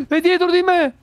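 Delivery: startled gasp, high and panicked; frightened cry, pitch jumping up; being chased. Real, not acted. Ghost right behind him.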